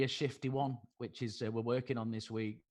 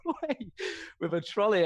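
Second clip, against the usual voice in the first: ordinary talk, nothing marked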